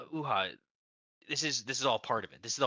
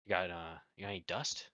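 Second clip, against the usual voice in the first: hushed; 9 semitones lower, 11 dB quieter than usual. Acted. Nervous buyer asking for drugs.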